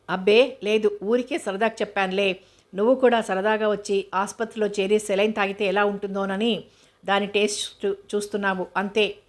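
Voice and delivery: usual speaking voice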